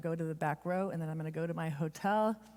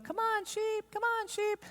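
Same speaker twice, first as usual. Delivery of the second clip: high, far-off sing-song call; stranger calling sheep. Retold or imitated. Random people at a field calling the sheep, in a high coaxing call. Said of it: "and they go".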